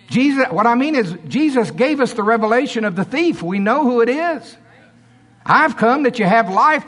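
high, forceful preaching. Real, not acted. Preacher telling church to run the devil off, full-force preaching.

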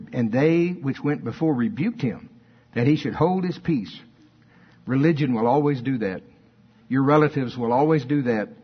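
ordinary delivery

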